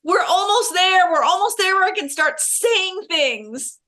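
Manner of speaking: eager. Retold or imitated. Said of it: "I'm like".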